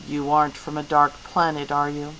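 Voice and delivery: ordinary delivery, nothing marked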